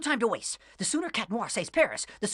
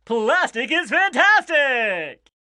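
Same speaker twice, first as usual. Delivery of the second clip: villain's slogan; 5 semitones higher, 6 dB louder, slower than usual. Acted.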